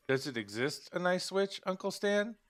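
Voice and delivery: put-on high-pitched little kid asking a question; child's voice. Boy asks Uncle Stan about nice witches, high child voice.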